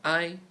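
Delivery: I held high and level, first tone. Shown as a demonstration. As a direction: sing I on a high flat pitch.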